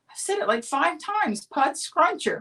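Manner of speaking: a first grader fed up with repeating; upset child. Retold or imitated. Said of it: "now I was getting really upset".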